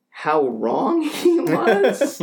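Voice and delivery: slow, high, breaking into real laughter; incredulous laughing. Real, not acted. Recalling the neighbor's invasion of space, incredulous laughing. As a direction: draw it out in disbelief and laugh through it.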